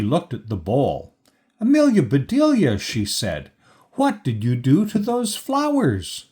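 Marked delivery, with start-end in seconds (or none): 1.6-2.8 high, loud, quick call of her name; exasperated scolding. A narrator's reading. Employer sees the ruined flowers. 3.9-6.2 high-pitched, exasperated question; dismayed demand. A narrator's reading. Employer sees the ruined flowers.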